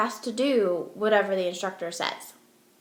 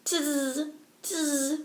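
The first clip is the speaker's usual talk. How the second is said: monkey chatter; 4 semitones higher, 5 dB quieter, much slower than usual. Acted. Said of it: "stomped both their feet at him and said".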